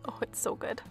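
normal talking voice

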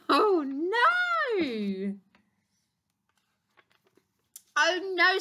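high, loud, wailing dismay; dramatic alarm. Acted. Doctor Duck sees gorilla blown away, dramatic alarm. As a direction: wail it in storybook panic.

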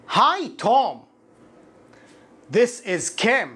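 careful teacher's model dialogue, paused between lines; slow, deliberate demo reading for students. Shown as a demonstration. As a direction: read each line slowly and carefully for learners. Teacher reads English dialogue slowly.

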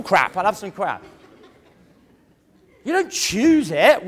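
ordinary talk, nothing marked

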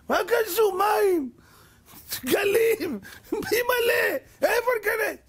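very high-pitched, wailing objection; panicked protest. Acted. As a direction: cry out in alarm at an impossible order. People panic at order to enter sea. (צווחת מחאה מבוהלת)

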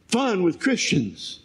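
usual speaking voice

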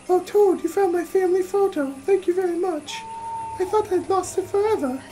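grateful Fran. Acted. Fran thanking Toad for her family photo, grateful and high. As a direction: play a thankful game heroine. Reads Fran's grateful reply.

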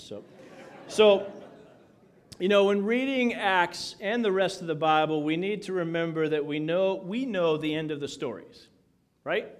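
ordinary talk

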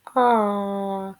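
А long and level; А as one sustained vowel sound; isolated sound. Shown as a demonstration. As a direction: hold the vowel А by itself. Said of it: "Останній звук".

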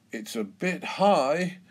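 a touch of worry about the height; nervous. A narrator's reading. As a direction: say it uneasily, lifting the pitch on the last word. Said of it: "says Daddy Pig nervously".

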